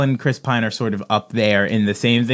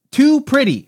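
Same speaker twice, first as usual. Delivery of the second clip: mock whine; 10 semitones higher, slower than usual. Mock exasperated repeat, far high.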